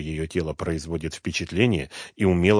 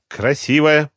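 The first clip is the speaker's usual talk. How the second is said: challenging, higher and louder; defiant; 8 semitones higher, 7 dB louder, slower than usual. A narrator's reading. Woman flaunts herself at Dronго, defiant. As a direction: throw it at him as a dare. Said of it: "повторила она с вызовом".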